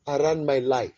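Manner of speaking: ordinary talk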